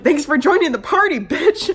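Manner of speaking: loud, laughing mock retort; laughing jab. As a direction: tease her through laughter. Laughing jab at late doctor.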